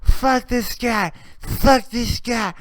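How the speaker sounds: put-on high, loud, slow drunk rant; drunk girl ranting. Drunk girl ranting at the guy outside the bar, high loud rant. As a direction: drag out the drunk cursing. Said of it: "she's like".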